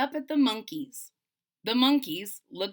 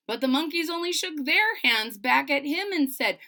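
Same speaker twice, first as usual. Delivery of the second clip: usual speaking voice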